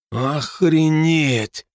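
slow, drawn-out stunned exclamation; stunned amazement. A narrator's reading. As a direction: let the shock stretch the word. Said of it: "выразил Дарт всеобщее изумление".

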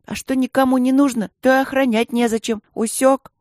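высокий, громкий мальчишеский спор; с напором втолковывай другу очевидное; задиристый Женька, возмущение. A narrator's reading. (звонко заспорь, как мальчишка)

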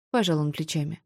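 plain reading voice

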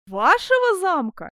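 high, loud, drawn-out echo; scornful, disbelieving question; incredulous challenge. A narrator's reading. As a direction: throw the claim back loudly. Repeats the rival's claim in derision.

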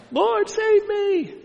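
high, drawn-out drowning wail; hammed-up cry for rescue. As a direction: stretch it into a panicked high plea. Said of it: "and start crying out".

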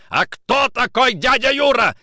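high, loud, furious question; angry shout. A narrator's reading. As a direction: yell the question at a suspect. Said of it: "закричал Никитин".

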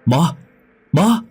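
loud, very high panicked scream; screaming a name. Acted. Mother waking to find her son gone, screaming. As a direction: shriek the boy's name in terror. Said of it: "Chị hét lên một tiếng".

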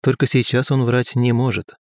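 plain reading voice

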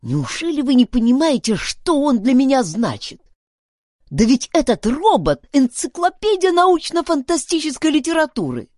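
high, loud, urgent appeal; impassioned pleading. A narrator's reading. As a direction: make them grasp what it means to you. Duncan pleads the robot's importance.

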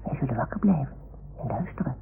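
hushed whisper while waiting. Acted. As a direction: whisper so no one hears. Fluisterend wachten op de zeehonden.

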